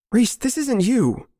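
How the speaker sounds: high, slow, imploring; desperate plea. Acted. Narrator pleading for mercy with his friend, desperate. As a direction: beg him to come back to himself, pitch high.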